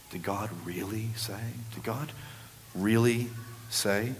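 very soft, crafty snake voice; hushed, insinuating serpent question; serpent's hiss. Acted. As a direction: murmur it slyly, then break it up word by word. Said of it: "with that hiss".

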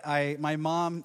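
usual speaking voice

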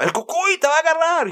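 high warning voice, in Spanish; scolding parent warning. Retold or imitated. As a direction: warn a child about el cucuy. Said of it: "if you don't behave yourself".

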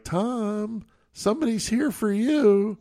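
high, drawn-out summons; boss calling out. Retold or imitated. Boss tells Tom somebody's here, high calling voice. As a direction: imitate a boss calling an employee up front.

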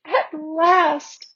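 high, overcome with relief; acted sobbing. A narrator's reading. Lucy burying her face in the mane, tearfully relieved. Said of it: "sobbed Lucy".

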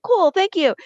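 high, chirpy little thank-you. Retold or imitated. Amanda thanking her friend, high and chirpy. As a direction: chirp it, pleased and light.

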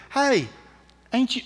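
high-pitched Southern schoolgirl; Southern US accent. Acted. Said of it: "I did a southern accent".

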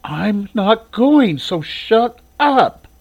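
put-on high, very slow, whiny girl; sulky refusal. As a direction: drag it out from under the covers. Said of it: "pulled the covers over her head and said".

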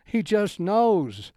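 "He just knows" said high, slow and emphatic; imitating a fervent friend, slow and high; wild-eyed insistence. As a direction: stretch "knows" out like it settles everything. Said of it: "he looked at me and kinda wild-eyed".

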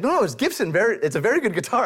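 high-pitched, insistent imitation; really emphatic seller. Preacher imitates guitar seller in India, emphatic and high. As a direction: imitate a pushy vendor insisting, pitch jumping up. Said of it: "like, really emphatic".

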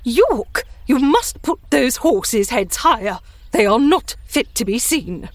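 haughty lady of the house giving orders; imperious. A narrator's reading. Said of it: "in an imperious voice she said".

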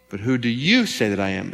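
who do YOU say, emphatic on "you"; stress on YOU. Shown as a demonstration. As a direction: lean on "you" with a rise and fall in pitch. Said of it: "There's an emphasis on it".

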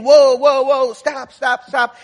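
loud, high panicked whoa to a bolting horse; frantic yelling at horse. Retold or imitated. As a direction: haul on the reins and shout stop. Rider yelling at runaway horse.

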